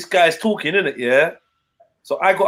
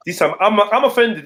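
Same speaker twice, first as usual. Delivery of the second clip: ordinary talk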